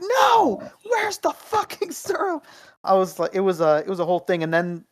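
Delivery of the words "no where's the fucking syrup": very high, drawn-out tantrum wail; mock a livid child's squeal; losing it. Kid refusing condensed milk on pancakes, retold tantrum. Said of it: "I was, like, losing my mind".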